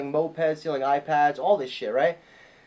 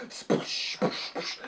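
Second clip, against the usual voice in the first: bottle smash noise; 9 dB quieter, much slower than usual. Retold or imitated. Bottles smashing sound effect.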